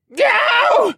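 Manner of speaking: very high, loud pained howl. A narrator's reading. Assassin is whipped with a fiery rope, howling. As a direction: howl the word out in agony. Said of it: "Dolian howled in pain".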